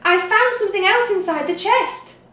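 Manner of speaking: breathless with the news; excited. A narrator's reading. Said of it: "she cried".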